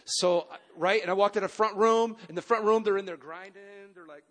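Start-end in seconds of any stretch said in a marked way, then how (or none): none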